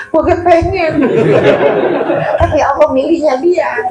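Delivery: high, laughing while quoting; laughing quote. Retold or imitated. Teacher retelling the wali's reply, laughing. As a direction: giggle through the old wali's answer.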